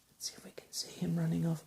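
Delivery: fast soft whisper. Real, not acted. Whispered aside to child.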